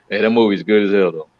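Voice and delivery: ordinary talk, nothing marked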